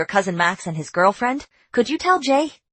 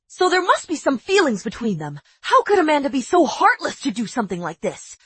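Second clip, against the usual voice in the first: usual speaking voice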